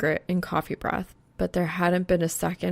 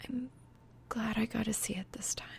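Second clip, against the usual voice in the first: soft breathy whisper, half to the night; whispered softly; 4 semitones higher, 11 dB quieter than usual. A narrator's reading. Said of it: "so softly it seems like she's talking".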